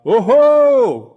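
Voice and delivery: high, loud call sent out across a valley; yelled call. Shown as a demonstration. Hiker on a mountain trail calling out to hear the echo, loud yell. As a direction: throw the call at the far ridge. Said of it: "compelled to yell out".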